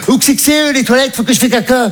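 high mock mother voice; mother's voice. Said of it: "elle fait".

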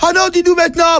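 high, fast, greedy squeaks; excited bats. Acted. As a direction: chatter high like hungry bats. Bats insist. (chauves-souris excitées, voix suraiguë)

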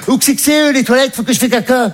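high-pitched mum in need; imitated mother. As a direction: ask urgently in a high mother voice. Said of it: "Puis à un moment, elle fait". (voix aiguë de maman pressée, imitée)

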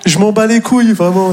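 high, strained comic protest; fed-up cry at a boring relative; exasperated outburst. Acted. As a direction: burst out high in mock exasperation. Exasperated answer to brother-in-law.

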